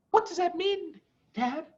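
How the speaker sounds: put-on high, curious child; a cub asking its dad; lightly voiced young cub; child's voice. A narrator's reading. Small fox asks father.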